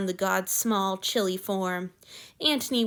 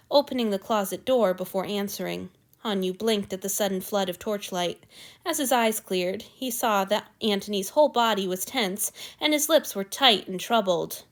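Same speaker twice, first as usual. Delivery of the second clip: ordinary delivery, nothing marked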